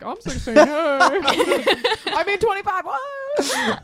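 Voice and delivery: high, breaking voice playing caught out; mock caught lying. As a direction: squeak out the correction in mock panic. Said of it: "You're like".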